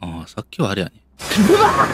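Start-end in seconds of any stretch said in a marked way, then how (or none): 1.5-1.9 very high, loud scream of fright; real jumpscare scream; terrified scream. Real, not acted. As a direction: shriek as something jumps out. Jumpscare, hair stood on end.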